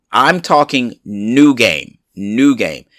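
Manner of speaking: slow, hit the word new hard on each new game; new drawn out and stressed. Real, not acted. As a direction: lean on new, slowly. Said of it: "Notice I said new".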